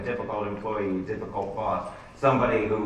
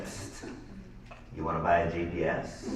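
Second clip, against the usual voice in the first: hooded merchant; 7 semitones lower, 8 dB quieter than usual. Retold or imitated. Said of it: "guy who came up to me after a show wearing a trench coat".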